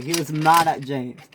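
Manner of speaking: normal talking voice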